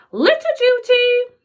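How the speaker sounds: high, loud sharp order; snapped. A narrator's reading. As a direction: snap it out like a strict teacher. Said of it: "the teacher snapped".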